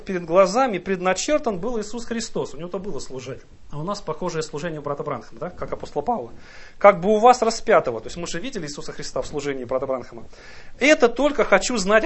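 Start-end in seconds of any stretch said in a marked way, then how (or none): none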